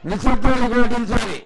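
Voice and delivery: loud announcement for silence; loud call. Real, not acted. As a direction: call out to the crowd. Temple official calls for silence.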